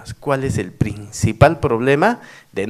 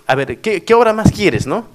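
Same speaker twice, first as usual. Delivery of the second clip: mocking, pitched higher and louder; sarcastic; 8 semitones higher, 7 dB louder than usual. Mayor mocking villagers' endless requests for works, sarcastic. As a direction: needle them with a sarcastic jab. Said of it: "yo un poco sarcástico les dije".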